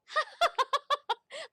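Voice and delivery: high, loud cackle; cracking herself up. Real, not acted. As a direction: burst out laughing at your own wit. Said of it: "I swear I crack myself up".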